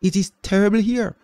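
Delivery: high, loud desperate plea; shouting. A narrator's reading. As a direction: cry out to stop her mother. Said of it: "She felt like shouting".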